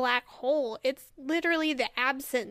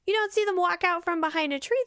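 normal talking voice

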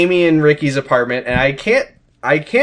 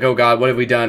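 ordinary delivery

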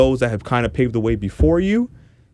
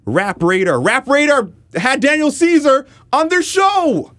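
indignant rant; 10 semitones higher than usual. Real, not acted. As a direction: rant at full tilt, pitch climbing high and words stretched out. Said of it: "my rant".

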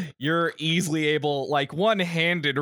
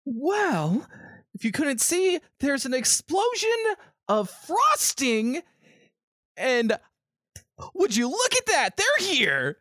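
much higher, breathless frightened voice; frantic npc woman; 12 semitones higher than usual. Acted. GM as the woman warning the party, high and frightened. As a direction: point out the horror in alarm.

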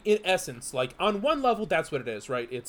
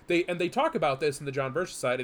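usual speaking voice

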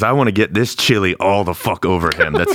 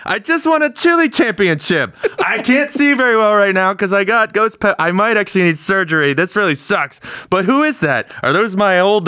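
much higher, giddy rambling; blinded winner babbling to old colleagues, high and giddy; giddy dazed champion; 12 semitones higher than usual. Acted. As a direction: babble it high like you can't see straight. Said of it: "and be like".

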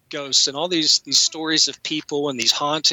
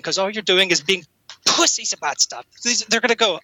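ordinary delivery

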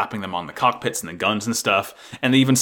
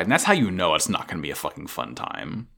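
ordinary talk, nothing marked

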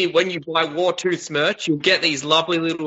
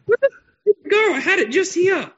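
high, fast and frantic; scramble, panicking as you search; 14 semitones higher than usual. Real, not acted.

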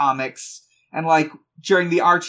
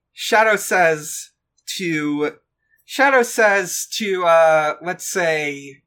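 usual speaking voice

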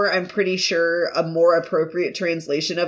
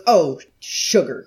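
serious businessman; 5 semitones lower, slower than usual. Acted. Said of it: "he's, like, this serious businessman".

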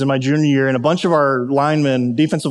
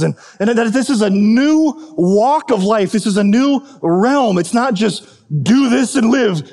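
higher, insistent preaching voice; 6 semitones higher than usual. Acted. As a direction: drive the sermon point home. Preaching the point.

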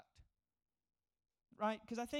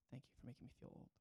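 breathy under-the-breath aside; whispered aside; 9 semitones lower, 16 dB quieter, much faster than usual. Real, not acted. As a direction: mutter it to yourself, quickly.

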